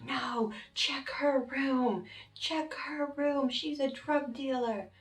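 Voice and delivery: high, slow accusing yell; mock shouting. Roommate urging cops to search her room, a high accusing yell. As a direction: insist at the top of your voice.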